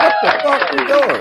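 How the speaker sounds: put-on high, loud bewildered kid voice; a startled child voiced high and loud; confused kid. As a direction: squeak out a confused kid's question. Kid confused as mama hides.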